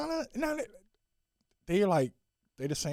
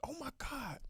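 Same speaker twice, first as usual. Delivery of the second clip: breathless whisper of awe; oh my God whispered in awe; whispered aside; 6 semitones higher, 18 dB quieter than usual. Real, not acted. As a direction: whisper it under your breath. Awed aside.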